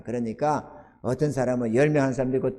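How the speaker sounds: usual speaking voice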